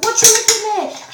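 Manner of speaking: loud, high acted challenge; confrontational snap. She confronts the boy staring at her, confrontational. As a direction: challenge him sharply, retelling it.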